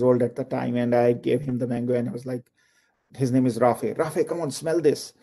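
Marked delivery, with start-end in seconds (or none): none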